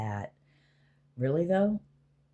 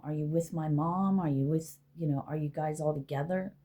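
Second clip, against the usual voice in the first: usual speaking voice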